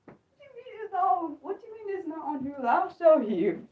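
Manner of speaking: very soft, high-pitched muttered disbelief; muttering to herself. Real, not acted. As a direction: grumble it under your breath while searching. Repeats the protest to herself while searching.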